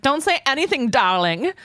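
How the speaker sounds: high, theatrical imitation; hammed-up spousal warning; imitated 'darling' line. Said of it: "I hated the way he said that".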